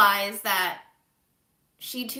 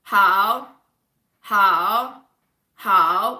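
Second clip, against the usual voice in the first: loud repeated how; 9 dB louder, much slower than usual. Retold or imitated. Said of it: "I was just like".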